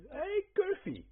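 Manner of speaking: high, bright call-out hello; excited greeting. Real, not acted. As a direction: call out happily to a friend joining. Greeting friend joining channel.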